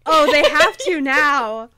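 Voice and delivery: slow, high, loud swooning exclamation; breathless dramatic gasp-line. Pretend. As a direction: draw it out like a swooning fan. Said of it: "The face you just made".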